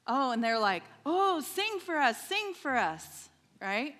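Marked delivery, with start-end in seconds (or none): 1.0-3.3 higher, louder mock pleading; eager crowd request. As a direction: imitate students clamoring for a song. Students eagerly begging her to sing.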